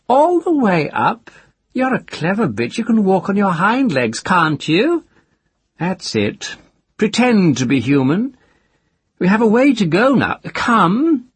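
high, loud sneering taunt; mocking captor. Captor mocks the girl on hind legs, loud and sneering. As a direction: taunt her like a trained dog. Man mocks his captive.

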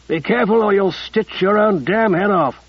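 put-on rough New York City accent; coarse New York accent. A narrator's reading. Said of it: "Casey's accent was undoubtedly American".

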